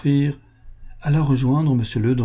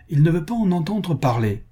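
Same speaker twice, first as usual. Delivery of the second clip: higher-pitched, annoyed retort; furious reply; 7 semitones higher than usual. A narrator's reading. Baroness telling Ledru of the refusal, understated fury. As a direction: report it crossly, pitched up. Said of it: "répondit la baronne furieuse".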